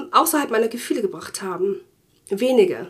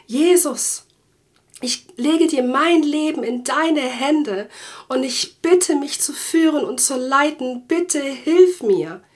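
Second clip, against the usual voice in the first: a little higher and slower, pleading to the Lord; earnest prayer; 3 semitones higher, slower than usual. Retold or imitated. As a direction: pray it out earnestly and slowly. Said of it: "immer wieder gesagt".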